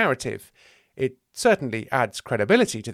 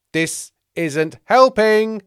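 slow, loud, high wail; aggrieved, stretched protest; exasperated complaint; 10 semitones higher, 8 dB louder, much slower than usual. Acted. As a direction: drag it out in frustration. Thomas exasperated by the chatter.

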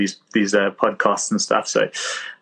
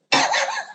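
short high laugh at himself; quick amused chuckle; sheepish laugh. Real, not acted. Laughs after tennis star joke.

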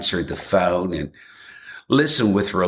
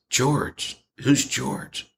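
lightly raised, puzzled question; a puzzled young mother, only a touch higher; confused; 4 semitones higher, 4 dB quieter, much slower than usual. Retold or imitated. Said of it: "the young mother said".

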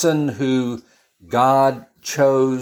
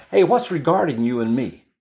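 mild challenge; 5 semitones higher than usual. Acted. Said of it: "one translation says".

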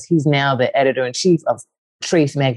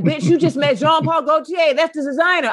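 high, sassy mock friend; high sassy disbelief; 13 semitones higher than usual. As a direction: tease her in a high voice. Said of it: "He was like".

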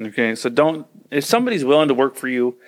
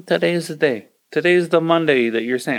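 ordinary talk, nothing marked

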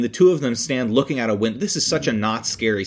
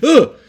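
high, loud cry of revulsion; mock disgusted yelp; 10 dB louder than usual. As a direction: recoil with a sudden acted yelp. Acts out disgust at maggots.

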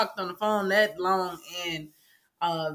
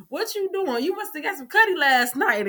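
teasing coworkers; 6 semitones higher than usual. Retold or imitated. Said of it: "Everybody like".

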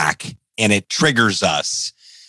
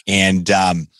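ordinary talk, nothing marked

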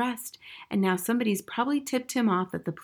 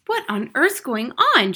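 put-on higher, louder bewildered child; cried out; 4 semitones higher, 6 dB louder than usual. A narrator's reading. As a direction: cry out in bewilderment. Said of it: "she cried".

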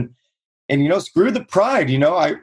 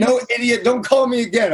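higher, dismissive mock brush-off; imitating rude homeowner; 6 semitones higher than usual. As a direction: snap it off like an annoyed stranger. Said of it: "they said".

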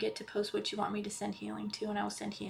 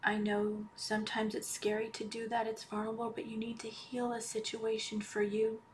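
guided meditation; 8 dB quieter than usual. Acted. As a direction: ease them into calm, almost whispering. Leads a healing meditation.

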